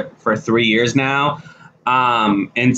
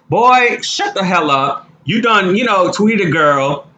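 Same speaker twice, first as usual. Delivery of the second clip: exasperated telling-off; 6 semitones higher than usual. Acted. Scolding the man who tweeted.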